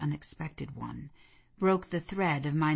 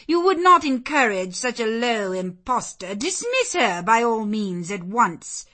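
loud, higher-pitched indignant lady; indignant cry; 6 semitones higher, 9 dB louder than usual. Acted. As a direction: protest loudly and order dismissal. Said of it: "cried Lady Ingram".

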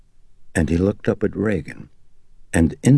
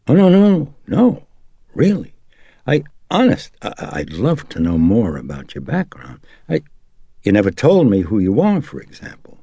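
higher, louder, hurried protest; earnest, placating priest; 8 semitones higher, 5 dB louder than usual. Acted. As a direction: stammer out an eager denial.

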